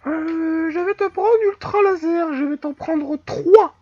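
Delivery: high, loud put-on voice; silly customer voice. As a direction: order in a squeaky, high-pitched voice. High put-on voice when buying.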